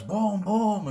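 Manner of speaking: two loud, high-pitched booms; imitated gunshots. Storyteller voices two shots his character hears, loud booms. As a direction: punch out two gunshot noises.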